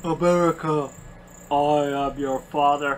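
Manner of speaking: deep, slow Vader voice; booming mock Vader line; Darth Vader impression. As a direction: intone it low and slow like the Sith lord. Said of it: "Darth Vader on Laser Harp".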